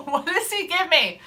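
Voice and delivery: usual speaking voice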